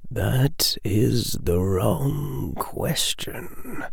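slow, hushed, dry whisper; slow rasping whisper from the mist; sibilant whisper. A narrator's reading. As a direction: whisper it like dust from the dark.

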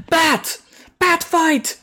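high-pitched comic cry at normal volume; hammed-up high exclamation with a laugh before it; mock bat shout. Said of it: "Probably just shouted "Bat".

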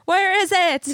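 high, loud playful shouting; mock frantic demand. Joking about shaking out library books, mock frantic demand.

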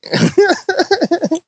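high burst of laughter; laughing. Real, not acted. Amused at a co-host being high, laughing.

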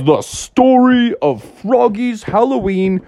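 high bright storyteller announcing the title; storybook opening. Acted. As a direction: announce the tale to kids.

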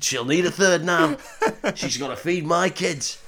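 lightly voiced silly bloke; same mock bloke. Parody Trevelyan talking about his kids, mock bloke voice. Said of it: "he's just like".

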